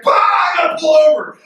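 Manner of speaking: loud burst of laughing excitement; loud, high hooting call to pull over; mock excited outburst. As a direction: blurt it out, cracking up. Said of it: "and be like".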